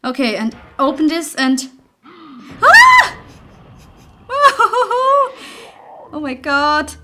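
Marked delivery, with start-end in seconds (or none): 2.6-3.2 scream. Real, not acted. Jump scare in horror game. 4.3-5.6 high, loud laugh after the scare; shrill nervous laugh. Real, not acted. Gamer laughing after being startled by the monster.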